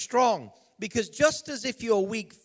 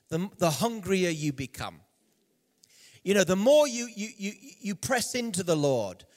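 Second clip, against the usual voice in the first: normal talking voice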